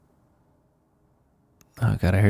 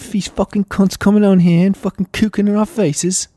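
very high, louder put-on voice; squeaky British lad; 13 semitones higher, 5 dB louder than usual. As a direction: rant like a shrill cartoon lad.

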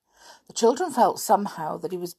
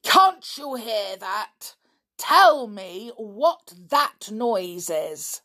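slow, loud, higher angry demand; bad temper; 5 semitones higher, 5 dB louder, much slower than usual. A narrator's reading. Stepfather facing the children over the noise, in a bad temper. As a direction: demand it crossly, word by word. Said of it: "in a very bad temper now".

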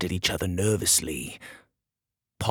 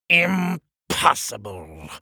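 higher and drawn out; slow angry disbelief; 4 semitones higher, much slower than usual. Acted. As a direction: stretch each syllable in furious disbelief. King repeats it, glaring at door.

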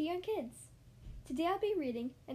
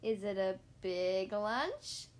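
slow, sly question with a grin; low start, swooping up on "lunch"; grinning; 5 semitones lower, much slower than usual. A narrator's reading. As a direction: stretch it out hopefully, rising at the end. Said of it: "Gerald says with a grin".

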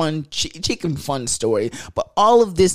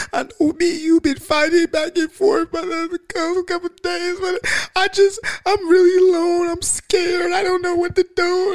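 high, whiny, frightened plea, much higher than his own; scared girl voice. Retold or imitated. The stranded girl telling him she is scared, in a high whining voice. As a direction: imitate her crying for help. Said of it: "She like, and then she like".

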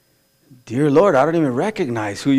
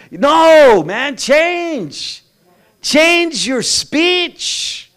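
loud, high, driving sermon; full-force preaching; 10 semitones higher, 6 dB louder, much slower than usual. Acted. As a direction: hammer it home from the pulpit.

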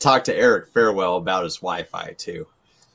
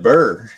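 shivering brr; 8 dB louder than usual. Acted. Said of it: "It's cold".

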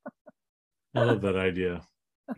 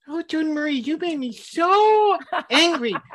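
silly voice; 4 dB louder than usual. Pretend. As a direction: turn anger into a squeaky joke. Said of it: "Instead, I'd be like".

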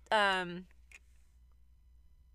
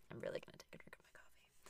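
soft quick whisper; 20 dB quieter, faster than usual. Real, not acted. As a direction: whisper it as an aside. Whispered aside before drinking.

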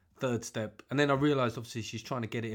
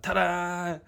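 higher, drawn-out mock fanfare; showy ta-da; 5 semitones higher, 4 dB louder than usual. Man showing off his cooking for applause, showy ta-da. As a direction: present it with a proud flourish. Mimes proudly presenting a dish.

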